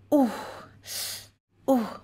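ordinary delivery

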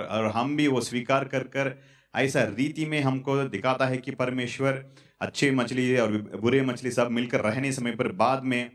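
normal talking voice

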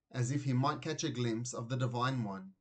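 ordinary delivery

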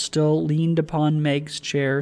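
ordinary delivery

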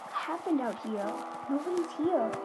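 normal talking voice

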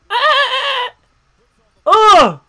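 loud, high yelp into a groan; high excited yelp. Real, not acted. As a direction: yelp then groan at the play. Reacting to a big play.

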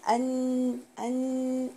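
أن with the n held and nasalized; nasal ghunna. Shown as a demonstration. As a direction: hum the n a little longer. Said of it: "بعمل فيه غنة". (غنة في النون المشددة)

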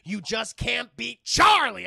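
loud, slow, high accusation; hammed-up furious rant; ranting commander. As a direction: hammer it out at the troops.

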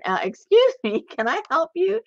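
mock indignant politeness. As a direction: play up the stranger's snooty challenge. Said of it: "he's saying".

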